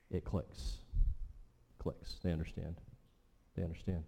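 breathy hush as the point sinks in; hushed whisper. Acted. Preacher whispers to his listeners as the meaning clicks. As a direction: whisper it, letting it land.

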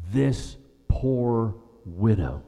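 this poor widow, stretched out slow; emphatic, slow. Shown as a demonstration. Preacher lingering on this poor widow, slow and emphatic. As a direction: let "This poor widow" hang, each word weighted. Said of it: "that's emphatic".

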